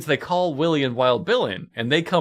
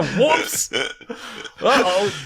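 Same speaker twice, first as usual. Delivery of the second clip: much higher, cartoonish oops; mock whoops; 8 semitones higher, much slower than usual. Bodies going over the ridge, cartoonish mock whoops. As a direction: squeak it like a cartoon blunder. Imagined team falling off ridge.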